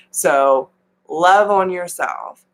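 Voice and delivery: ordinary delivery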